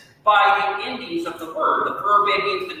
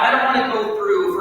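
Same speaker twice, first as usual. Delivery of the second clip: normal talking voice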